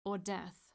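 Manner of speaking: "Or death" as a firm statement, no questioning rise; "Or death" delivered as a declaration, not a question. A narrator's reading. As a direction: state "Or death" with weight on "Or", closing the matter. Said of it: "not as a question, but as a statement".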